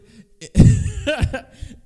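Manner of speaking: loud high squealing laugh; high shriek laugh. Real, not acted. Laughing outburst.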